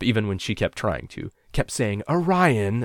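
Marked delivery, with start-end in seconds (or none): 2.1-2.8 high, loud reproving call of the name; serious mother voice. A narrator's reading. Mother trying to stop laughing, serious scolding voice. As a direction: say the name as a warning. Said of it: "in her serious voice".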